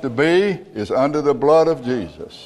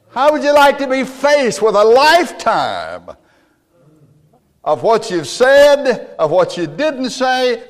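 much higher, louder impassioned sermon; full-force preaching; 10 semitones higher, 5 dB louder than usual. Acted. Preacher warning the congregation of judgment, full force. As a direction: drive it home to the congregation.

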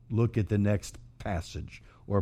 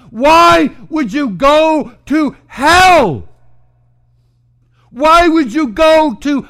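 loud, high pleading cry; Jesus pleading with sinners, loud and high; 14 dB louder, slower than usual. Acted. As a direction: plead with them like they're drowning. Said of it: "can you hear him pleading with them".

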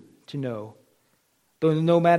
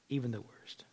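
whisper; 5 semitones lower, 16 dB quieter than usual. Real, not acted. Preacher ending on grace to sinners, whispered. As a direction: breathe even the worst without voice.

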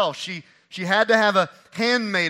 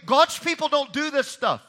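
preaching at full force; 6 semitones higher, 7 dB louder than usual. Retold or imitated. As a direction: thunder it from the pulpit. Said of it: "She's saying".

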